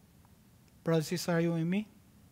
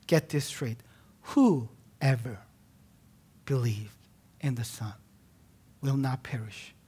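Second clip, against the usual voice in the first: clear and deliberate; 2 semitones lower than usual. Real, not acted. Preacher making sure listeners get it clear, deliberate delivery. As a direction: set each phrase down firmly with pauses between. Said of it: "let me say this clear".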